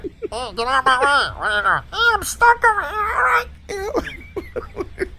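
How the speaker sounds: tiny high robot-car voice, slow at the end; squeaky little stuck drone. Pretend. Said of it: "give 'em little, little voices".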